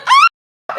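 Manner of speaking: very high, loud yelp; fangirl scream. Retold or imitated. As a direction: reenact an excited little scream. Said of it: "I am, like, screaming".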